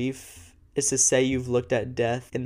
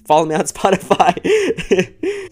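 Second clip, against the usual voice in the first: louder, higher, slow with a laugh; laughing plea; 7 semitones higher, 8 dB louder than usual. Real, not acted. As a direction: giggle through the ask. Playful sign-off to listeners.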